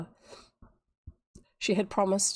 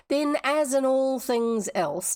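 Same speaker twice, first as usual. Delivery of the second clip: slow, higher, emphatic declaration; declaring; 6 semitones higher, 4 dB louder, slower than usual. A narrator's reading. Tavia on correct pronunciation, declaring. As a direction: proclaim it with mock solemnity. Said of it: "declared Tavia".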